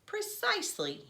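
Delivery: high-pitched, emphatic agreement; earnest agreement. A narrator's reading. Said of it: "as if he were talking to a grown-up".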